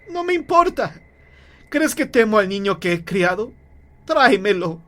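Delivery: high, insistent cry; defiant shout. Acted. Mother demands her son back from husband, shouting. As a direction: brush off his fear and demand. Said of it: "gritó la mujer".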